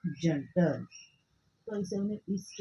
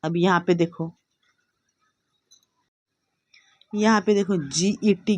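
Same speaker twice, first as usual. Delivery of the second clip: loud classroom voice; 12 dB louder than usual. Real, not acted.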